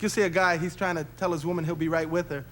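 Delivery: usual speaking voice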